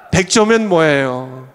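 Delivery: ordinary talk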